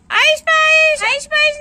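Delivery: put-on high, loud child shout; gleeful call-out. Girl shouts to the friends she has found, high and loud. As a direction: shout it like you just caught them.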